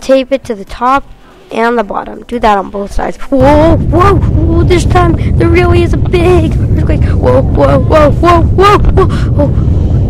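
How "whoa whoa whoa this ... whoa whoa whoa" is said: loud wobbly whoa calls, pitched up; hammed-up earthquake panic. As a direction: shout whoa like the ground is shaking. Acted earthquake shaking, loud.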